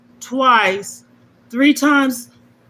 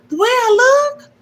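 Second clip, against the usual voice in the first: much higher, louder incredulous question; mock indignant; 14 semitones higher, 5 dB louder than usual. Refusing a compliment, mock offended high squeak. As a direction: throw it back, offended. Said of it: "turn around and say".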